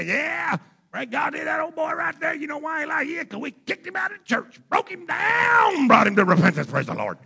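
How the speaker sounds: high, shouted mock gloating; mock self-righteous churchgoer. Pastor mocking a smug congregation, high gloating shout. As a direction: ham up a smug church member crowing.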